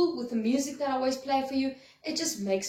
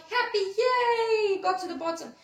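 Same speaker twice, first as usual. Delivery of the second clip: gleeful pleasure; 8 semitones higher than usual. Retold or imitated. Teacher acting a child sliding down, squealing with glee. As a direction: cry out with delight, stretching happy yay.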